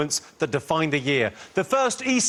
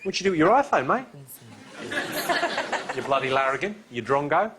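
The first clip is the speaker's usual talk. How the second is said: attempted Australian accent; 2 semitones lower than usual. Host teasing an Australian iPhone buyer, mock Australian accent. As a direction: tease an Aussie teen in his own Australian accent, lightly done. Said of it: "I'm trying to do your accent".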